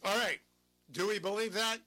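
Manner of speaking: loud, sarcastic rhetorical question; loud scoffing challenge. Real, not acted. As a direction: throw the question at the audience in scorn. Host scoffs at the clip.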